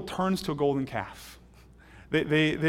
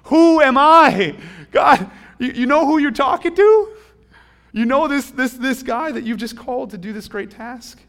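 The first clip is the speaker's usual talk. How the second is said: anguished Moses; 9 semitones higher, 12 dB louder than usual. Acted. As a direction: cry out to God in disbelief at being chosen. Moses protests his calling to God.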